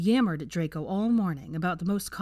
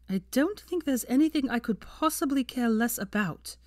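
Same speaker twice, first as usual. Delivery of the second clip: higher, scornful dismissal; sneering; 6 semitones higher than usual. Acted. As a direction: brush him off with contempt. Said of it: "she sneered".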